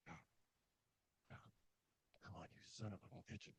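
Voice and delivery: tense whisper through the teeth; whispered curse. Retold or imitated. Climber coaxing a shaky piton, whispered. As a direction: breathe the curse, barely voiced.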